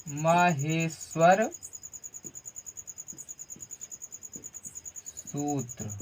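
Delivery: drawn out and slow, fading to a murmur; slow dictation. Shown as a demonstration. Teacher dictating the title to the students, slowly. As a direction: dictate it slowly for note-taking. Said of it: "अब यहाँ लिखिएगा".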